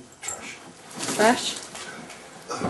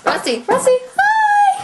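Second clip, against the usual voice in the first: high dog-talk voice; 5 dB louder than usual. Real, not acted. Greeting the dog.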